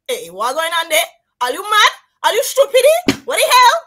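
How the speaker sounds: high, loud, indignant Grenadian accent. Shown as a demonstration. The Queen challenging Barbados, loud Grenadian accent. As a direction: demand answers in an animated Grenadian accent. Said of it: "If I did that in Grenadian".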